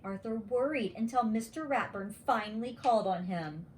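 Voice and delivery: plain reading voice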